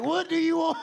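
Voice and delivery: high, loud mock protest; exasperated outburst. Acted outburst about his one ticket.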